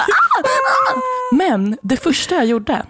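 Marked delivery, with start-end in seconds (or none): 0.0-1.0 high yelping scream; retold scream. Girl spat on in the street, high screaming wail. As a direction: let out a high shriek. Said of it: "jag står där och bara".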